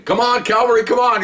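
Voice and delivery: will the backup to hurry; urgent inner plea. Retold or imitated. Waiting for backup, pinned suspect.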